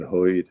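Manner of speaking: "hoid" with an oy vowel for heard; New York "hoid". Shown as a demonstration. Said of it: "a fun way to pronounce".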